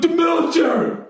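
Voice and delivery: high, whiny heckler voice; mock heckler. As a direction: mimic him, high and pleading. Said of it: "He's like".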